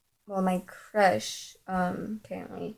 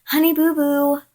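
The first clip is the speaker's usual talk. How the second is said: high, louder cooed pet name; imitated girlfriend; 6 semitones higher, 5 dB louder than usual. Girlfriend calls her boy, high imitated voice. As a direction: call out as a mock girlfriend, pitched up.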